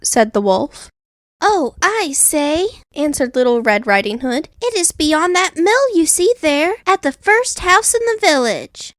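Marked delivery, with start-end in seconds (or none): 1.4-2.7 put-on higher, innocent little girl; sweet, trusting young girl; the girl answering the wolf, higher and innocent. A narrator's reading. Girl voiced in story. 4.6-8.9 put-on higher, innocent little girl; sweet, trusting young girl; the girl answering the wolf, higher and innocent. A narrator's reading. Girl voiced in story.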